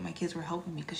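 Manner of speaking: hushed whisper to the camera; whispered aside. Real, not acted. Vlogger confiding to viewers about the scratches, whispering. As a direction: lean in and whisper it.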